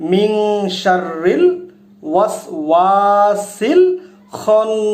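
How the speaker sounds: each word চান্টed separately with pauses; broken into parts. Shown as a demonstration. Teacher breaks the verse into pieces for viewers. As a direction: recite it word by word. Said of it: "ভেঙে ভেঙে পড়ি".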